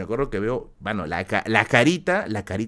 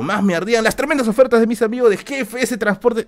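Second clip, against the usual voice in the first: loud, higher announcer-style plug; mock ad read; 10 semitones higher, 7 dB louder than usual. Joking sponsor plug for a transport company, loud ad voice. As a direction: sell it like a radio sponsor spot.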